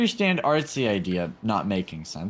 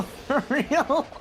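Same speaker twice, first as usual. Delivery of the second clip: laughing; 12 semitones higher than usual. Real, not acted. Laughing joke in group chat.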